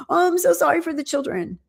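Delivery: a hammed-up, insincere sorry; mock apology. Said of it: "And then she's like".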